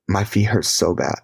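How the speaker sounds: ordinary talk, nothing marked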